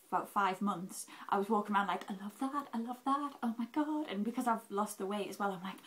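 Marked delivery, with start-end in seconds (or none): 2.1-4.1 higher, giddy retelling of a shopper possessed; mock shopping frenzy. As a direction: gush over everything in sight. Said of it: "I was a woman possessed".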